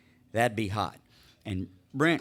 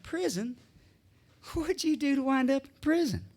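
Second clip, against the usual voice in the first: shocked barfly woman; 11 semitones higher, 5 dB quieter than usual. Retold or imitated. Joke, barfly woman reacts.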